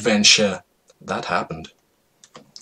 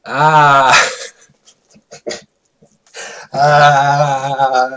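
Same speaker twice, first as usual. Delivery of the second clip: loud hammed-up birthing scream, breaking into a laugh; mock labor scream; 3 semitones higher, 5 dB louder than usual. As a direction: yell it out like a cartoon woman in labor. Pam going into labor.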